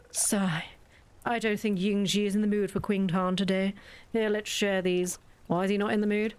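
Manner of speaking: ordinary delivery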